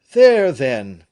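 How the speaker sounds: loud, urgent exclamation; all cry out at once; alarmed outcry. A narrator's reading. The friends cry out at the bad news, alarmed. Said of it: "cried every voice at once".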